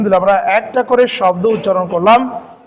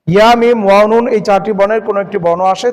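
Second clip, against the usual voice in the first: ordinary talk, nothing marked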